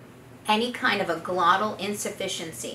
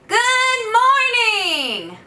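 high, loud, slow greeting; calling over the fence; 13 semitones higher, 5 dB louder, slower than usual. Shown as a demonstration. Coach calling good morning to a neighbor over a fence. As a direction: call it out bright to a neighbor. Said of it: "Loud, energized, high-pitched".

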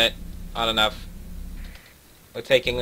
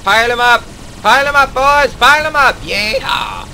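rowdy high battle cry; 11 semitones higher, 10 dB louder than usual. Real, not acted. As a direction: roar like a brawler.

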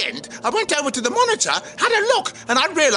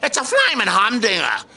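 put-on broad Australian accent, lower; 4 semitones lower than usual. Shown as a demonstration. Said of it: "practice my Australian flaming accent".

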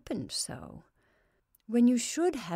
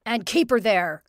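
angry; 9 dB louder than usual. Acted. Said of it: "finished Joe Doan".

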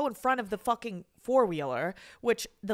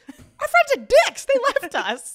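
high, loud indignant squawk; retold outrage; 13 semitones higher, 10 dB louder than usual. Stranded friend answering rescuers, mock outraged yell. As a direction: protest loudly, comically wronged.

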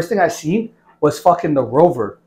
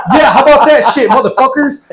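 loud, raised gotcha with laughter in it; retold gotcha shout; 6 semitones higher, 5 dB louder than usual. Instructor reveals himself to card-playing recruits, gloating loudly. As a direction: imitate springing the trap on them.

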